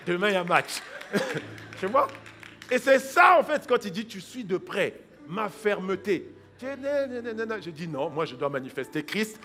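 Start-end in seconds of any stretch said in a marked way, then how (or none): none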